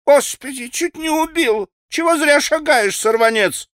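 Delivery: put-on high, squealing old woman's wail; писклявый вой старушонки. A narrator's reading. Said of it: "Раздался пескливый вой какой-то старушонки". (писклявый старушечий вой)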